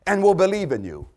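high, loud taunting demand; mocking demand. Crowd taunting Jesus, demanding a miracle loudly. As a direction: throw the challenge up at him. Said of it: "they said to Jesus".

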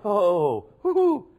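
high, loud exultant whoop; cheering woo-hoo. Acted. Celebrating his tribe.

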